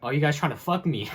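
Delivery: loud, high imitation of the boss yelling; mock angry yell. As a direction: belt it out like the furious boss. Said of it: "yelling at me".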